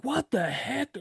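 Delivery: high, pinched angry yelp; angry cuss. Retold or imitated. Angry farmer finding dogs in his house, high outraged yelp. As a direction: spit it out like a furious old farmer. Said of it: "and he's pissed".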